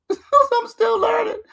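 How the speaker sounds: high, slow fake sob through laughter; hammed-up teary whine, laughing; mock crying. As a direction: whimper it like a mock cry, high and drawn out.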